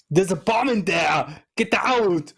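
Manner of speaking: light Austrian action-hero bark; a hint of Arnie giving an order; Arnie impression. Shown as a demonstration. Host shows the lines his Arnie can manage, lightly Austrian. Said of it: "Arnie can't do those words".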